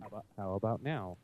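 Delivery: far-off muffled voice; distant, like yelling from the next room; from another room. Real, not acted. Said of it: "you still kinda sound like you're in Alaska".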